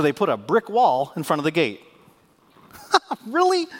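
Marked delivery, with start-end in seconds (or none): 3.3-3.7 incredulous. Real, not acted. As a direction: scoff at the absurdity. Incredulous mockery of the wall.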